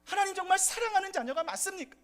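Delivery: high, loud anguished complaint; complaining to God. Retold or imitated. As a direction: cry out to God in frustration, pleading. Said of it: "하나님께 이런 불평을 할 수 있습니다". (하나님께 높고 크게 부르짖는 불평)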